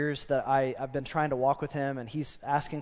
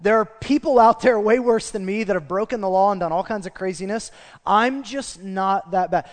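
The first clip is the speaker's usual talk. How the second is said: mock self-justifying; 3 semitones higher, 6 dB louder than usual. Pastor voicing typical Oklahomans before the judge, mock smug protest. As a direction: play the smug Oklahoman who insists he's not that bad. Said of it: "People in Oklahoma".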